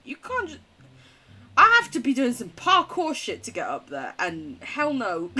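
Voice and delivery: ordinary delivery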